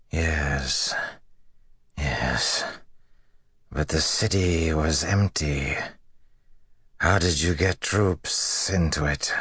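slow, lightly voiced dying officer; a hint of a spent, wounded commander; weary wounded general. A narrator's reading.

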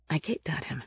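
plain reading voice

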